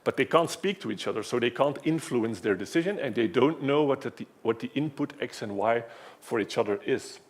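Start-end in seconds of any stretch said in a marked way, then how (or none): none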